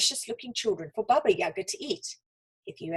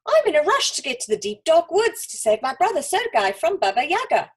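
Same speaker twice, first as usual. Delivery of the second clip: cried out; 5 semitones higher, 6 dB louder than usual. A narrator's reading. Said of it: "cried Olga".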